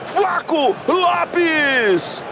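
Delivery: long drawn-out shouted name; goal commentary. Real, not acted. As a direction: stretch the name out.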